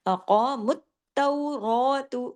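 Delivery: अक़ामुत तौरातु chanted slowly, with रा stretched; slow recitation. Shown as a demonstration. Teacher reciting the word to her class, slow melodic chant. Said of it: "देखिए रा".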